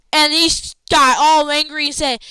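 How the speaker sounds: ordinary talk, nothing marked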